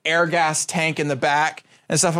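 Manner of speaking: ordinary talk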